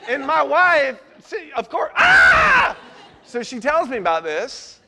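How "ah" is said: loud high mock shriek of fright; a retold frightened scream; startled scream. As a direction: yelp like someone caught off guard. Wife frightened by the witch.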